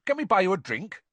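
high, eager offer; bright. A narrator's reading. Fred offers Bagman a drink, bright and eager. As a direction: offer it cheerily. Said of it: "said Fred brightly".

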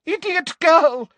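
high, exasperated shouting; angry shout. A narrator's reading. Umbridge disappointed by the answer, shouted angrily. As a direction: shout it scornfully, pitch way up. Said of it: "shouted Umbridge".

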